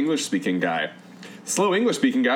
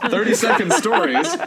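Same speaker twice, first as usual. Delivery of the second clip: laughing title; 7 semitones higher, 5 dB louder than usual. Real, not acted. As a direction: read the title through leftover laughter. Rereads title while still laughing.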